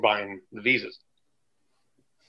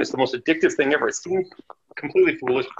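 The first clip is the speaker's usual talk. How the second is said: usual speaking voice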